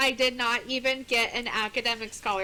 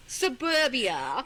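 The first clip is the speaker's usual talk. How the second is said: slow, stretched mock Scottish accent; much slower than usual. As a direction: drawl it in a hammed-up Scottish accent. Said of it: "we're doing Australian accents for Scottish".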